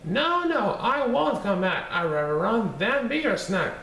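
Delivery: high, sing-song taunting cookie voice; bouncy little gingerbread man bragging; cheeky gingerbread man. Acted. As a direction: tease them from far out of reach.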